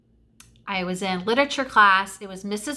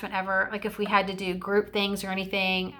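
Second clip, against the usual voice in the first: normal talking voice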